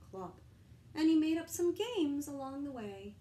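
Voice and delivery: plain reading voice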